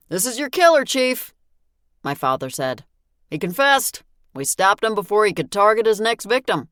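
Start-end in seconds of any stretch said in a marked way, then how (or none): 0.1-1.3 raised, higher father voice; father's voice. Acted. Father reports the killer. 3.3-6.7 father's voice. Acted. Father explains to the chief.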